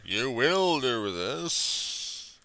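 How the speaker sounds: slow, drawn-out commanding voice; mock hypnotic command. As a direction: lay the command on slowly like a stage hypnotist. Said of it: "telling the masses in my own damn videos".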